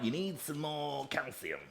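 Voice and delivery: slowed, lightly put-on dietitian's accent; Vicky's accent. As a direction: mimic a coach's accent. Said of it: "she said it in that exact accent".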